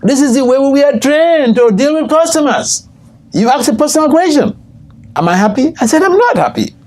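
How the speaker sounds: high-pitched, insistent retold confession; imitated official explaining. Airline official confessing to a customer, high-pitched explanation. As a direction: act out the official's high protest.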